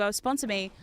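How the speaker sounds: normal talking voice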